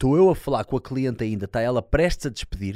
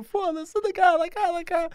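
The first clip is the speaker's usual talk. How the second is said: frantic. Retold or imitated.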